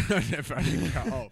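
high, loud put-on voice, laughing through it; mock character line. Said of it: "And he goes".

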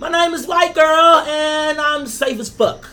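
exaggerated squeaky-clean girl, accent dropped; Catch Me Outside. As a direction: pitch it way up, prim and drawn out at the end. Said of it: "that little accent went away".

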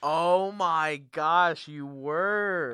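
loud, high, drawn-out disbelief; astonished recall. Real, not acted. Suddenly remembering a friend's old costume, loud astonishment. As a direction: stretch every word in real amazement.

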